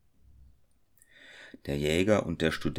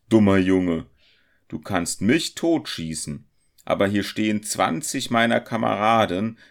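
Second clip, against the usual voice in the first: loud, forceful, raised voice; thundering robber; 4 semitones higher, 7 dB louder than usual. A narrator's reading. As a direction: argue back loudly with a threat underneath. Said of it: "schrie der Räuber mit donnernder Stimme". (laut und drohend, mit kräftiger Stimme)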